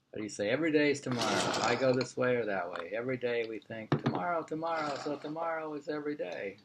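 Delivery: ordinary delivery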